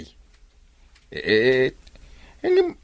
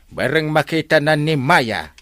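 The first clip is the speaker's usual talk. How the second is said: ordinary delivery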